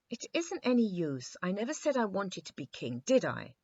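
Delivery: a touch of sulky irritation; a little cross. A narrator's reading. The king snaps at Eyebright, a little cross. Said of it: "said His Majesty a little crossly".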